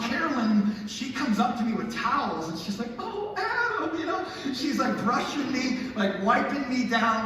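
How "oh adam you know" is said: mock doting mom voice. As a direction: coo it like a fussing mother. Mom tending bleeding boy.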